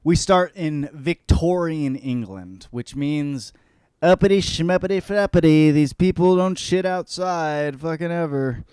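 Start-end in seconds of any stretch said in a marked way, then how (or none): 4.0-5.7 higher, louder snooty voice; hammed-up upper-class babble; mock posh gibberish. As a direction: sneer it like a stuck-up aristocrat. Mocking Victorian upper class.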